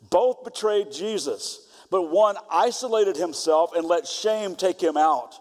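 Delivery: ordinary talk, nothing marked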